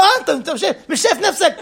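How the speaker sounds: high, laughing jab at a friend; laughing friendly mockery; laughing tease. Real, not acted. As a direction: tease him through laughter. Teasing the friend while laughing.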